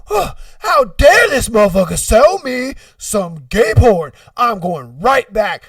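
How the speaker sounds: loud huffing outrage; gasp, then declare how offended you are; mock indignation. Buyer indignant about the tape.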